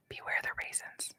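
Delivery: spooky whispered warning; hushed ominous warning; creepy whisper. Pretend.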